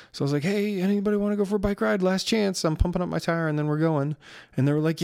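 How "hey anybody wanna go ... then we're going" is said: eager call. Real, not acted. Calling the family to ride.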